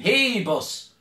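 loud, high call with a long first word; acted holler, stretched out; shouted hail. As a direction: shout after someone walking away. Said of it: "Jesus shouted at him".

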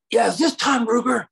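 high, loud imitated caller; angry caller. Ralph Bakshi calling the writer, high loud mock voice. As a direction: bark it down the phone as the angry caller. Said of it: "This is Ralph Bakshi".

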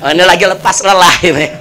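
chuckling low while saying it; a husband laughing off his wife's nagging; amused, smiling. Retold or imitated. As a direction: shrug it off with a laugh. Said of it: "dia senyum aja ya".

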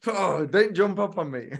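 lightly voiced startled mum; a hint of his mum's scolding fright; mild mum voice; imitating mum. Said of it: "She gets so scared".

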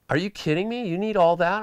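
high, incredulous retold reaction; shocked disbelief. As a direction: play his jaw-dropping disbelief.

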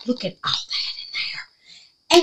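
a hushed, delighted look; awed whisper. Real, not acted. Showing viewers the gifted kit, whispering. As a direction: breathe it in wonder.

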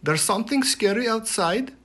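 put-on high, bright girl calling the chief; a young girl alerting the chief; Helga's voice. A narrator's reading. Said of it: "Helga said".

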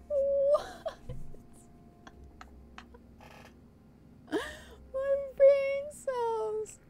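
squeaky, very high laugh-crying; wheezing giggle through words; helpless laughter. Real, not acted. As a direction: laugh till it breaks your voice. Cracking up at forum post.

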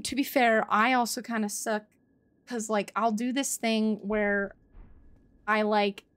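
ordinary talk

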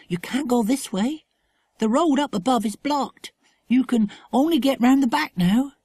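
put-on high, earnest girl's voice; little girl warning. A narrator's reading. Tassie warns the children.

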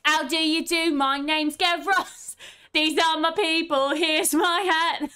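put-on cheeky Cockney street kid introducing himself; hammed-up Cockney Gavroche; Les Mis line. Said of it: "I'm just gonna do the line from, uh, Les Mis".

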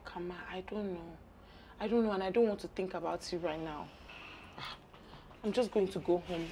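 very soft, low, defeated voice; dejected murmur. Acted. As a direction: answer as if all hope is drained. Just fired, answers friend wearily.

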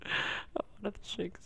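breathy, disappointed near-whisper; deflated muttering; sad mumble. Real, not acted. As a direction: mumble it under your breath, crestfallen. Disappointed missed catch.

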